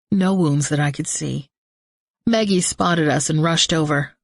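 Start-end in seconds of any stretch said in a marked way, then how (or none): none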